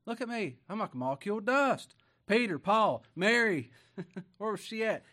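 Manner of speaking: loud fervent preaching. Acted. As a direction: preach it out with fire. Preaching at full force.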